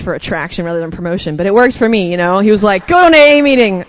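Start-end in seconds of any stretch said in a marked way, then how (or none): 2.9-3.8 loud, high insistent order; retold dad's command. Dad ordering her to a meeting, loud and blunt. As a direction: bark it like a fed-up dad.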